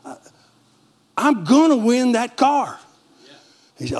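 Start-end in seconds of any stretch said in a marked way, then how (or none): 0.1-2.8 fast, higher, eager insistence; bursting with excited certainty; eager retold plea to the pastor. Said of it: "So I got excited and I said".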